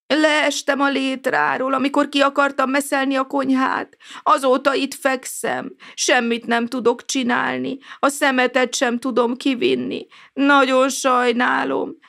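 put-on an injured old neighbour apologizing from bed; sorry, ashamed old man voice; apologetic old man. A narrator's reading. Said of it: "mentegetőzött".

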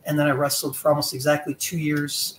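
ordinary talk, nothing marked

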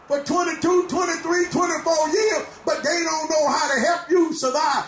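full-force preaching, shouting over the organ. Real, not acted. Preacher exhorting congregation.

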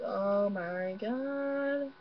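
very slow, stretched-out mock dread; reenacted slow-motion horror; drawn-out dread. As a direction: drag out every word, last one held long. Acting out dread while looking up.

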